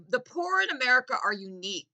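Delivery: ordinary talk, nothing marked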